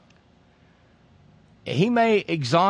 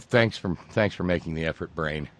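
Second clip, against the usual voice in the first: normal talking voice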